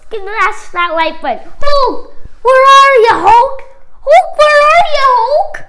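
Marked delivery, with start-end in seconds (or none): 1.6-5.6 high, loud calls for Hulk; calling out. Real, not acted. Kid calling for Hulk at the outro, loud call. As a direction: yell across the room to find him.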